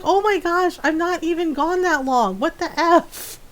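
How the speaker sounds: high-pitched mock exasperation; exasperated. Owner answering clingy pets after a shower, exasperated. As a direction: protest in disbelief, pitched well up.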